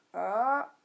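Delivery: "A" stretched, then climbing at the end; dipping tone. Shown as a demonstration. Teacher repeats the third tone, dipping-rising "A". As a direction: hold "A", then swing it back up. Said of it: "faut bien remonter".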